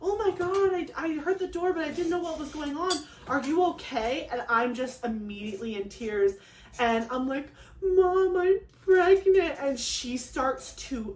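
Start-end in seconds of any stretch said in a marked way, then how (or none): 0.0-4.3 imitating mom. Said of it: "She's like". 7.8-9.6 higher, louder, slow choked announcement; tearful blurting. Retold or imitated. Daughter telling mom she's pregnant, tearful and higher.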